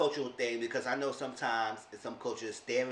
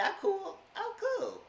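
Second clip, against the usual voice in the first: lightly voiced Will. Voicing Will agreeing.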